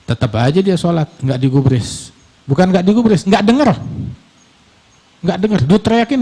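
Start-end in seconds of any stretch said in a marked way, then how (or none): none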